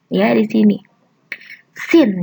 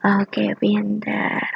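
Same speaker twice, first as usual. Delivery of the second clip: whisper; 5 semitones lower, 7 dB quieter, much slower than usual. Real, not acted. Praising pupils softly.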